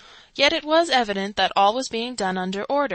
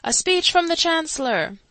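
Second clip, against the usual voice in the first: higher call from far off; higher distant shout; 9 semitones higher, 4 dB louder than usual. A narrator's reading. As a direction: call it out across the square. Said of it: "a single voice in the distance was understood to shout".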